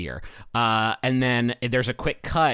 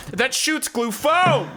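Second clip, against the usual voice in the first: "foam" hit high and drawn out; slow, high imitation leaning on "foam"; stress on foam; 11 semitones higher, slower than usual. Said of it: "places the emphasis on foam".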